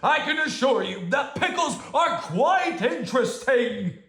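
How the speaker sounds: loud protest. Acted. Bear keeps shouting.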